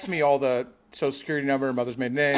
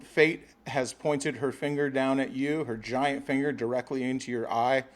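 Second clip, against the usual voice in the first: mock epic narration; 3 semitones lower, 5 dB quieter, slower than usual. Mock grand tale of fate.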